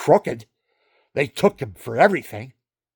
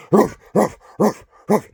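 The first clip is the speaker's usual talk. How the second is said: mock dog barks, a bit louder; gruff barking; 5 semitones higher, 6 dB louder than usual. Husband plays the barking dog at his wife. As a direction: bark it out like a big dog. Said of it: "And I go".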